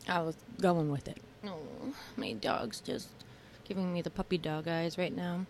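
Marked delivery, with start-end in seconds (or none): none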